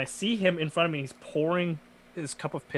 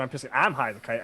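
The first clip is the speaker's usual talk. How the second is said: normal talking voice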